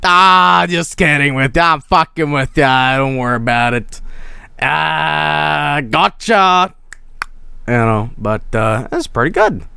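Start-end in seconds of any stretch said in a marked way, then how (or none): 0.0-6.7 loud, drawn-out mock taunt; hammed-up, higher-pitched joshing; teasing gotcha. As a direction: tease them with a big "just kidding". Teasing gotcha after the clip's joke.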